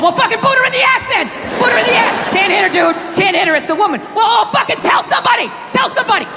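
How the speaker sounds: high, frantic, pleading voice; a panicky inner voice blurting ideas; squeaky desperate brain voice. Retold or imitated. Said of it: "And it's just spitball".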